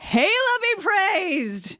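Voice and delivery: high, slow battle cry; roar. Acted. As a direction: roar it to the gods. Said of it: "I roar".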